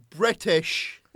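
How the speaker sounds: British shouted high and loud; loud insistence. Real, not acted. Correcting a co-host on his show's origin, loud insistent shout. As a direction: bellow British to win the argument.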